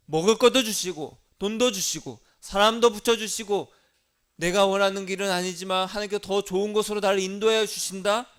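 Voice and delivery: loud, rising proclamation; fiery preaching. Real, not acted. Pastor promising provision to the congregation, fiery preaching. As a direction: preach it with mounting fire.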